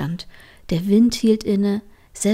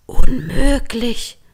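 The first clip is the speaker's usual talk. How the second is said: slow awed whisper; slow disbelieving whisper; 4 semitones higher, 9 dB louder, much slower than usual. Acted. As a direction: breathe it out in disbelief. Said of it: "flüsterte sie".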